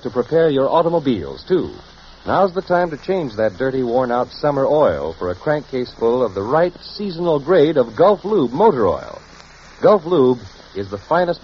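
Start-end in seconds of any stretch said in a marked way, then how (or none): none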